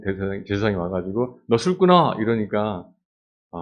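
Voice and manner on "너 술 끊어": high, loud snap at her; sharp scold. Acted. Priest Eli orders Hannah to quit drinking, sharp and loud.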